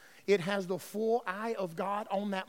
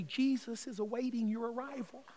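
very soft, gently lowered voice; soft, tender angel voice; 9 dB quieter than usual. Acted. Angels greeting a dying believer, soft and tender. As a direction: speak tenderly, as an angel welcoming a soul. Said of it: "in the most soft and tender voice".

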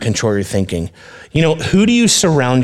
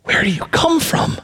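Pilate's voice. Acted. Pilate voiced in Bible reading.

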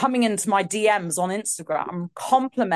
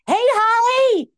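much higher, louder, slow sing-song greeting; 7 dB louder than usual. Retold or imitated. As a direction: squeal the hello like an excited star. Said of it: "she was like".

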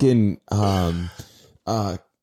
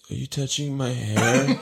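calm star voice; 3 semitones lower, 4 dB quieter, slower than usual. Retold or imitated. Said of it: "He's like".

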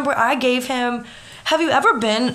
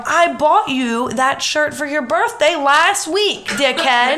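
higher, punchy retold comeback; indignant retort; 4 semitones higher, 4 dB louder than usual. Retelling how she caught her boyfriend lying about a picture, indignant. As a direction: call out his lie with mock outrage.

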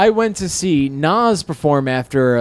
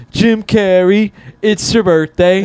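high, loud, drawn-out rapper call-out; imitated stage shout; 5 semitones higher, 4 dB louder, slower than usual. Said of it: "at one point he goes".

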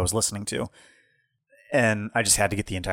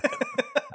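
laughing; 5 dB quieter than usual. Real, not acted. Laughing at the joke.

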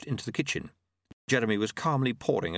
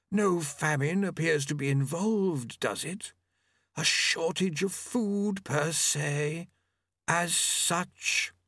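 high, slow, drawn-out character voice; fastidious Famine, objecting; 7 semitones higher, much slower than usual. Acted. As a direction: pick at the point in a high, slow voice.

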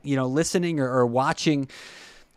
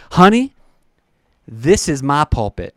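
put-on Southern accent; 5 dB louder than usual. Southern hairdresser declaring her calling to a client, Southern accent. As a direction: lead with a lilting Southern "Honey". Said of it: "with that Southern accent".

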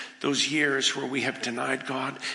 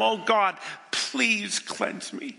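higher, slow, pleading cry; pleading prayer; 7 semitones higher, much slower than usual. Acted. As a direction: beg God to cleanse you. Voiced plea of sinner.

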